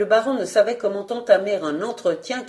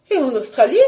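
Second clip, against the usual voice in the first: higher, challenging query; insistent raised-pitch wife; persistent questioning; 4 semitones higher, 4 dB louder, faster than usual. Acted. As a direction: press on with a rising question. Wife fires off another destination.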